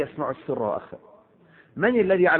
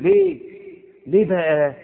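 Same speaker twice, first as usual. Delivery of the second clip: much higher, louder cry of disbelief; incredulous why; 4 semitones higher, 5 dB louder, slower than usual. Real, not acted. Preacher challenging the fearful man, shocked cry. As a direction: shoot up in disbelief.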